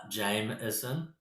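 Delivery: "Jameson" slowly, questioning, voice rising; soft, slow, unsure attempt at "Jameson". Shown as a demonstration. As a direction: draw out JAYM-is-un as a tentative question. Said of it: "how, s-, slowly".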